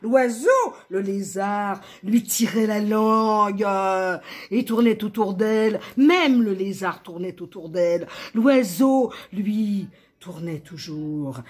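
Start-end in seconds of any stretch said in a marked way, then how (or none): 0.0-9.9 high, loud, mounting list; heightened narration. A narrator's reading. Narrator naming those circling her, heightened. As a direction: make the memory whirl and swell.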